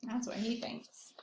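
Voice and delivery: teasing whispered aside. Real, not acted. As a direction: whisper it behind the pigeon's back. Aside about the pigeon.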